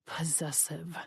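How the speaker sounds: low, breathy vampire whisper; whispered correction from the jealous vampire; menacing whisper. Acted. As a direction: hiss it softly, possessive. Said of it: "he corrected".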